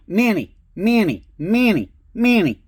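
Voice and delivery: calling the dog. Retold or imitated. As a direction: call out across a cold park. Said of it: "I'm like".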